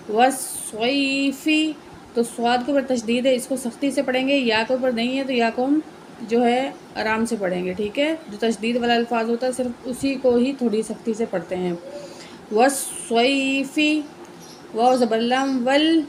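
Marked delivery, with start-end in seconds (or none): 0.1-1.7 every sound stretched very slowly; slow blended reading. Shown as a demonstration. Teacher joining syllables into a word for the pupil, very slow. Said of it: "تو ان کو ہم ملا کے پڑھتے ہیں". 12.5-14.0 every sound stretched very slowly; slow blended reading. Shown as a demonstration. Teacher joining syllables into a word for the pupil, very slow. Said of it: "تو ان کو ہم ملا کے پڑھتے ہیں".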